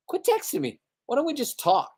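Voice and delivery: high, fast mock-exasperated plea. Telling his friend Dan to stop texting, high mock exasperation. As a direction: throw it up high like you're fed up. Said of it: "I'm like".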